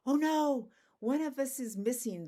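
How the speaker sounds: high, loud cry of panic; panicking. Acted. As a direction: shout the bad news in alarm. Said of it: "They're, like, panicking".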